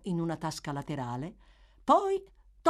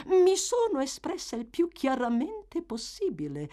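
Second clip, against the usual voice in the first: higher, cool and even; calm matron; 11 semitones higher, 4 dB louder than usual. A narrator's reading. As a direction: answer with icy calm. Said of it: "con la massima calma".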